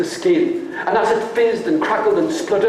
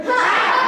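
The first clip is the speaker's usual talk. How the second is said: a sharp, high mocking Ha!; scornful ha. Shown as a demonstration. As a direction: spit out a scoffing laugh. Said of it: "Can you do that with me".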